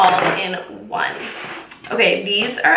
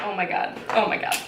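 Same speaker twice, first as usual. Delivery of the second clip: breathless oh my god, very soft; hushed awe; 8 dB quieter, faster than usual. Real, not acted. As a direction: gasp it softly in amazement. Awed by the eyeshadows.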